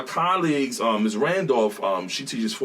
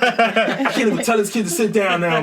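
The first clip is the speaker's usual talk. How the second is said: laughing; 8 semitones higher, 5 dB louder than usual. Retold or imitated. Laughing self-quote.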